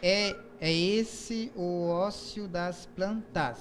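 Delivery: "é esse o ócio das plantas" counted out very slowly; slow metrical scansion of the line; syllable counting. Shown as a demonstration. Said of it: "Aí, o segundo verso".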